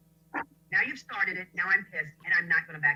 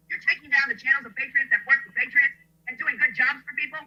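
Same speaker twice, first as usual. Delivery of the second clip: usual speaking voice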